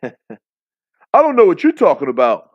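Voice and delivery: loud, high-pitched mock British accent; hammed-up, loud British protest. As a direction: put on a bad British accent, loudly. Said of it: "the worst British accent I've ever heard".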